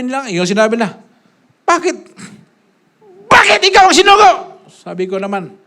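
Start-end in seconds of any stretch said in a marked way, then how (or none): 1.6-4.3 high, loud indignant demand; challenging critics. Retold or imitated. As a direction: throw the question at him.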